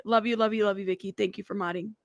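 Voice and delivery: ordinary talk, nothing marked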